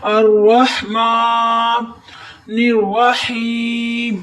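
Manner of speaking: الرحمن الرحیم with throat ha and long ee, slow; throat ha, then hold the ee; throaty ha, held ee. Shown as a demonstration. Repeats recitation demo. (حلق سے ہا اور یا مدہ پر ٹھہراؤ)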